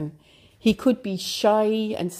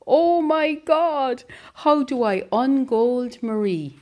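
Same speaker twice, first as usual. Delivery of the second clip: anguished cry; 7 semitones higher than usual. Acted. Hero crying out over golden Marie, anguished. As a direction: cry it out in despair. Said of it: "he cried into the air".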